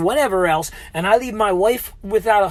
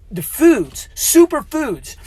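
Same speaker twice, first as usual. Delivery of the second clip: emphatic; 7 semitones higher, 8 dB louder, much slower than usual. Real, not acted. Excited about superfoods.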